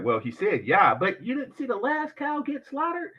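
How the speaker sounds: normal talking voice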